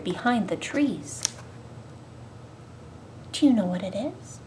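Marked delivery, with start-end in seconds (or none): none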